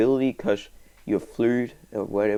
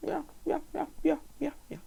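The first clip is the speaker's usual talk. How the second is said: high squeaky yeah yeah, softer; 10 dB quieter, much faster than usual. Pretend. Gamer chirping yeahs in a high squeak.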